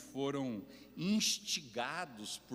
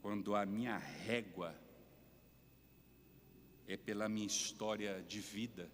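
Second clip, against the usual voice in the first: ordinary talk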